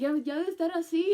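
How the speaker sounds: high, loud and fast; excited rapid blurt. Retold or imitated. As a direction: rush the words out in disbelief. Reacting to pregnancy timeline.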